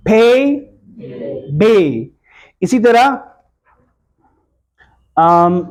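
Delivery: ordinary talk, nothing marked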